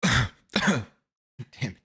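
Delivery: real coughing fit, words pushed out after; choked on a drink, cough then curse. Real, not acted. Streamer choking on fizzy water, coughing curse. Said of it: "that went down the wrong pipe".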